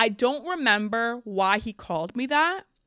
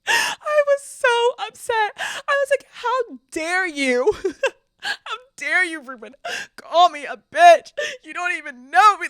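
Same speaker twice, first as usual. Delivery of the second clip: high, loud, hammed-up tearful wail; mock sobbing; 10 semitones higher, 8 dB louder than usual. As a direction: wail it in mock outrage through laughter. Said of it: "I started sobbing".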